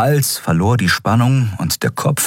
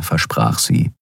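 usual speaking voice

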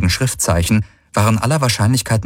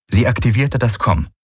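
ordinary delivery, nothing marked

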